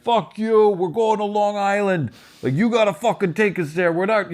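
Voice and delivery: high, heated demand at the cabbie; angry yelling. Retold or imitated. Friend yelling at the cab driver, angry and high. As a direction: shout down the driver. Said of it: "yelling and fighting".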